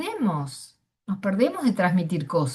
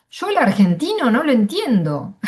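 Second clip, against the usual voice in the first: stepson's complaint, louder; imitating the stepson, louder; quoting the stepson; 4 semitones higher, 7 dB louder than usual. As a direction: say it as the puzzled young man, with a louder push. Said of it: "se refería a, a mí con mis hijos".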